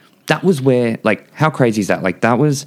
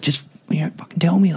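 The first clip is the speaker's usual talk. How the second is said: higher-pitched, hushed exasperation; frustrated; 9 semitones higher, 4 dB quieter than usual. Retold or imitated. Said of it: "I was getting really frustrated".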